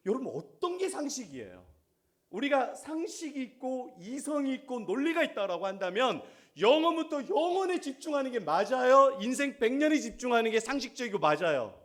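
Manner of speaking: loud, high, pressing rhetorical challenge; full-force preaching. Real, not acted. Preacher challenging the congregation's priorities, loud and forceful. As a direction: hammer the question at the congregation.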